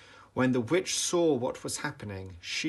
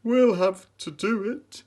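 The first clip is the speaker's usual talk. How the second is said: lion resolving; 10 semitones higher, 9 dB louder than usual. A narrator's reading. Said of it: "said the lion".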